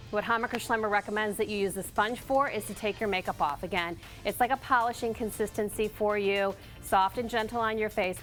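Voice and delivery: normal talking voice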